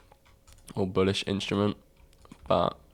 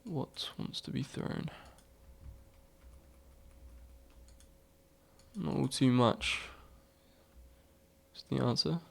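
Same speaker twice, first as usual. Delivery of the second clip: classical stage actor; 4 semitones higher, 10 dB quieter than usual. Pretend. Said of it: "am I talking like a Shakespearean".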